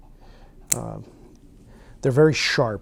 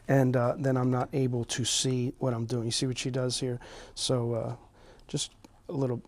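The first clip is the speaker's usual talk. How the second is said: ordinary talk, nothing marked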